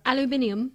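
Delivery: aluminium as al-yoo-MIN-ee-um; British pronunciation. Retold or imitated. Co-host shows how Britain says aluminium, al-yoo-MIN-ee-um. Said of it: "as they say across the pond".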